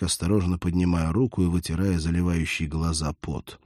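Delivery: plain reading voice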